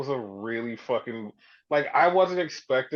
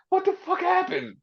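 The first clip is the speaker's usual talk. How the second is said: baffled. Retold or imitated. Punk repeating his question, high stunned disbelief. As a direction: pitch it way up in disbelief. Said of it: "He literally just says".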